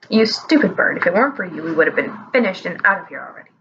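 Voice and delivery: put-on low grumbling old man; old wizard scolding. A narrator's reading.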